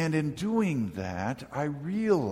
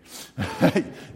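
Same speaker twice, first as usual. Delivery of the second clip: real laugh; 6 dB louder than usual. Real, not acted. Teasing Judy in front row.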